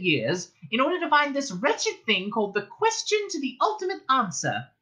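high, earnest little mouse; Benji mouse voice. A narrator's reading. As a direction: explain like a small official. Benji explains to Arthur.

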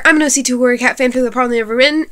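ordinary talk, nothing marked

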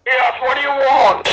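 loud low gruff hello; gruff supervisor. Acted. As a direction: bark it like a grumpy boss.

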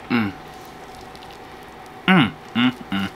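chewing while humming; muffled through food; mouth full of food. Real, not acted. Seen 0: Taco held in mouth, biting and pulling through the labelled words.

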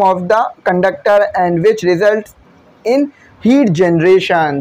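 very slow, measured read-out; reading a statement. Real, not acted. Teacher reading the law aloud to students, slow deliberate read-out. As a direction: read out the textbook statement word by word. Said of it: "statement से पढ़ देता हूँ".

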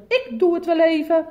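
high, loud bossy nurse. Acted. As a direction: take charge brightly. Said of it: "riep de zuster".

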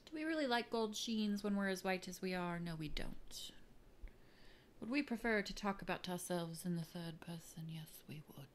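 whispered theatrical musings; mock dramatic whisper. Vlogger whispers grand quotes to herself in mock drama. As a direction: hush it like a pompous poet. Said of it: "Quoting things again".

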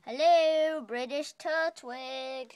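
mock British accent, loud drawn-out hello. Vlogger calling for a hidden friend, mock British accent. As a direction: call out slowly in a put-on British accent. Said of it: "I just said something in British".

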